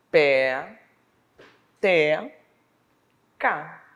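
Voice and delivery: "Pé... te... ca", each syllable held, long gaps; very slow, syllable by syllable. Shown as a demonstration. Teacher showing pupils three syllables, very slowly. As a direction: stretch and separate every syllable. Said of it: "Se eu falar bem lentamente".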